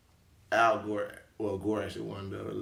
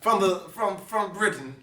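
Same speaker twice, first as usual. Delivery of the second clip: loud, higher-pitched stab at British; mock British accent; 10 semitones higher, 7 dB louder, slower than usual. Host announcing co-host's title, in mock British. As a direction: proclaim it in a put-on British accent. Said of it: "how you say it in British".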